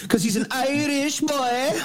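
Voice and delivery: high, drawn-out stage-Irish jeer; hammed-up high Irish lilt; mock Irish accent. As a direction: tease him in a squeaky, stretched fake Irish. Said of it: "how do we do that in an Irish accent".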